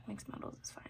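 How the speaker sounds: soft unvoiced whisper. Real, not acted. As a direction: breathe the words out quietly. Repeats the line as a whisper.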